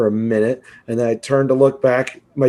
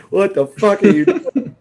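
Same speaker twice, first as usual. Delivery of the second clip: exasperated laughing yell; 7 semitones higher than usual. Retold or imitated. Yelling at drunk dad fighting cop.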